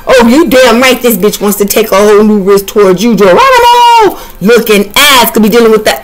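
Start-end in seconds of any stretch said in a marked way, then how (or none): 3.2-4.2 jumping-in cry. Acted. Jumping-in exclamation.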